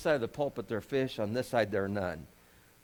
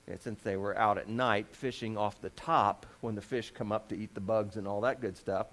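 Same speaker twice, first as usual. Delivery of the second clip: ordinary talk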